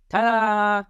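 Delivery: ordinary delivery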